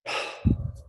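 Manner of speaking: slow deep audible breath out; one long breath from the belly; diaphragmatic breath. Shown as a demonstration. Coach shows the reset breath before the calm take. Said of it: "take a one single diaphragmatic breath".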